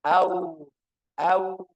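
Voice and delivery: AU clean and loud with nose held; clear, non-nasal AU; sound from the mouth. Shown as a demonstration. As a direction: send all of AU out through the mouth. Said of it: "Suaranya dari mulut".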